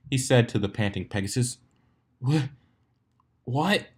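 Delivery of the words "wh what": out of breath. A narrator's reading. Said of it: "answered through heavy breathing".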